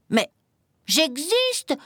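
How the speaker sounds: high-pitched mild yell of despair; acted little chick's protest; desperate cry. A narrator's reading. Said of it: "hurla le petit poussin vert désespéré".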